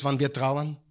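ordinary delivery

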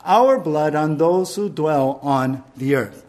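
ordinary talk

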